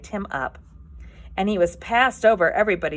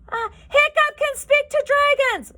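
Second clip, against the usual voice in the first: much higher, louder announcement; loud shout; 8 dB louder than usual. Acted. Fish Legs telling the whole tribe, loud shout. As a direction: yell it for the whole crowd. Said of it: "shouted Fish Legs very loudly indeed".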